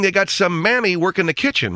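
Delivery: ordinary delivery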